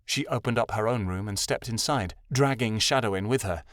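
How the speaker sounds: ordinary delivery, nothing marked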